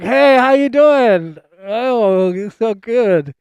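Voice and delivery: loud, high, drawn-out cartoon greeting; talking nose hairs. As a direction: holler it as goofy, over-friendly nose hairs. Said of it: "They're like".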